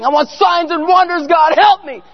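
high-pitched, hammed-up pleading to God; mock desperate prayer. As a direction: wail it like an overdone prayer. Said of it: "praying and fasting".

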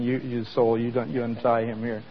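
usual speaking voice

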